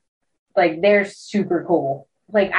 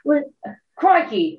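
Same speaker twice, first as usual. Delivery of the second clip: put-on Australian accent; 7 semitones higher than usual. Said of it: "my accent should be way better".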